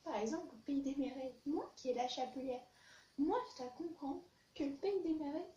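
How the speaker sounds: normal talking voice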